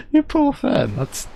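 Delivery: very high then slow through laughter; laughing pity. Real, not acted. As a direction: pity him while cracking up. Laughing at friend's misfortune.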